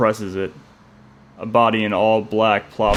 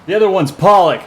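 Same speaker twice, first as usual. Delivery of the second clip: put-on high, loud young yell; 10 semitones higher, 8 dB louder than usual. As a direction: call it out triumphantly. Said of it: "a young voice yells".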